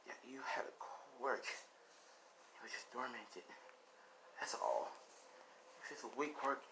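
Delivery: a mother spending her last breath; fading last words. Acted. Dying mother urging son Izuku to become a hero, hushed.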